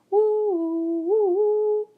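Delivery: high loud sung woo woo; sung high train whistle. A narrator's reading. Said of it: "The train whistled".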